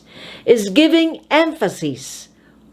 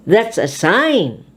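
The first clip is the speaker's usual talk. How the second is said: "sign" raised, stretched and falling; stress on SIGN. Shown as a demonstration. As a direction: lift the voice on "sign". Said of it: "raise your voice on the noun".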